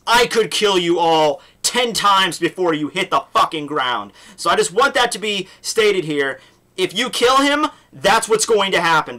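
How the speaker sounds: acted-out menace with punched words; retold stern threat. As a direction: lay down a dramatic ultimatum.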